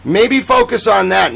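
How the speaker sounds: normal talking voice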